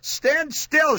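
high-pitched yelled order. A narrator's reading. Mother with trapped finger orders son, yelling. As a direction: yell it in pain. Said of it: "she yelled".

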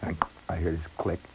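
ordinary delivery

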